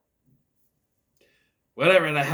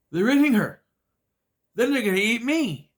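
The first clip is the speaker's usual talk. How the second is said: hammed-up panic, higher and louder; mock terror; 8 semitones higher, 7 dB louder than usual. Reviewer imitating Arnold's infamous panicked line. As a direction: shriek it like a bad horror actor. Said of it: "the other infamous line".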